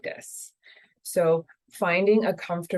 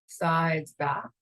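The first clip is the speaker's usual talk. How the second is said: calm, unhurried meditation guide voice; guided meditation. Acted.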